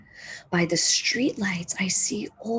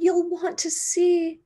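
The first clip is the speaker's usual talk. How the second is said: much higher and louder eager plea; begging; 9 semitones higher, 5 dB louder than usual. Acted. Marcy begging Old Man Hammer to come, eager high plea. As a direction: pull him along, pleading. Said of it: "I beg".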